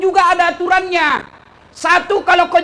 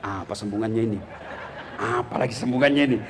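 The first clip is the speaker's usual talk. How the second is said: low, hushed murmur to himself; mengingat-ingat; 7 dB quieter, faster than usual. Acted. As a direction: imam groping quietly for the lost verse. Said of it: "dia ingat-ingat". (bergumam pelan dan rendah sambil mengingat-ingat)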